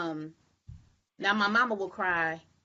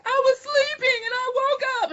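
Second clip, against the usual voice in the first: put-on much higher, louder wailing little-girl whine; mock crying child; 6 dB louder than usual. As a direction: imitate a sobbing daughter just woken up. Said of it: "Sade will wake up and she'll be like".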